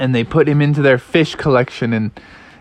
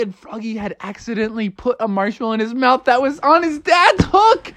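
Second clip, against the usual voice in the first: ordinary delivery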